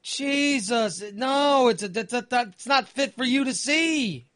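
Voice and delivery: high, pleading "don't clean the toilet"; horrified inner plea. Retold or imitated. Picturing his wife scrubbing his mess, high horrified plea. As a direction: beg her in his head to stop, slowly.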